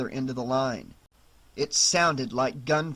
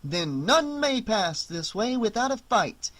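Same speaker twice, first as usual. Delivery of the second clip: bold, lordly knight voice; proud and haughty challenge; 6 semitones higher than usual. Acted. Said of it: "in a bold and haughty manner".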